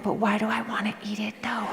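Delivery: put-on soft whispering child; whispered kid question. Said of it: "you're like".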